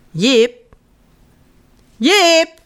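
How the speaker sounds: calling. Acted. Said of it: "staat al heel lang te roepen".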